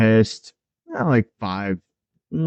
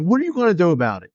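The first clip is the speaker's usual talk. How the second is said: cocky taunt; 7 semitones higher, much faster than usual. Retold or imitated. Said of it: "And Lance Lynn's like".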